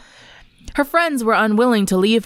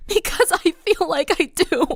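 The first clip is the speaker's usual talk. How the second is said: tearful confession; 9 semitones higher than usual. Acted. Sunset tearfully tells Luna she deserves it. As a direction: admit the guilt through tears. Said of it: "Sunset sobbed".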